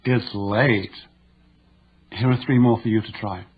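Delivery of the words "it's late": lower, playful, teasing "It's late"; flirtatious. Shown as a demonstration. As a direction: make "It's late" an invitation.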